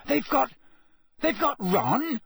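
high, halting shock; gasped. A narrator's reading. Harry learning his friend is taken, gasping in shock. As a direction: stammer it in horrified disbelief. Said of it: "Harry gasped".

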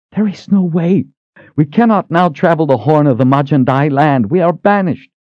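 high, sharp refusal; Sister Verna in a rage. A narrator's reading. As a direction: refuse him in disbelief.

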